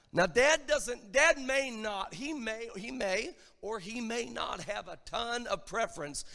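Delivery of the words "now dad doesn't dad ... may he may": normal talking voice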